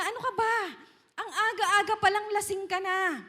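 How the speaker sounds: a stern priest snapping at her; scolding priest. Acted. Priest Eli scolds Hannah, thinking she's drunk, loud and sharp. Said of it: "Sabi niya".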